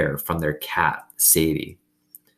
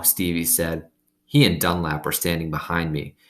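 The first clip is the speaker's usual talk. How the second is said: usual speaking voice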